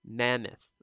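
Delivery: "mammoth" with MAM stressed, weak second syllable; stress on first syllable. Shown as a demonstration. Teacher models the word for students, "mammoth" stressed up front. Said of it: "it's how you say it".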